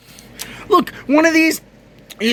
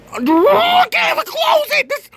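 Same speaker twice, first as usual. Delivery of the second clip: frustrated shout. Real, not acted. Vlogger yelling at his broken laptop, exasperated shout. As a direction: yell it at the laptop that won't shut. Laptop hinge won't close.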